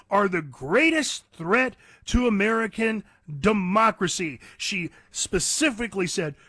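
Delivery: ordinary talk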